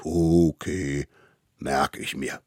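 a hint of a slow mammoth; lightly voiced mammoth.

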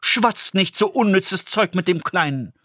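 a hint of a mother telling someone off; annoyed mother. Hanne rebuking the old woman for scaring her son. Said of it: "sagte endlich die Frau des Schusters".

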